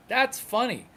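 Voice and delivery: normal talking voice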